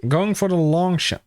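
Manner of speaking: ordinary talk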